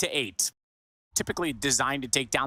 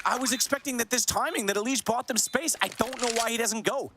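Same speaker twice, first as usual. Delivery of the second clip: rapid hyped play-by-play, pitched up; esports caster; 7 semitones higher than usual. Real, not acted. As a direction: call the action like a live caster. Casting live play-by-play.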